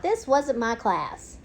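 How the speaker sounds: usual speaking voice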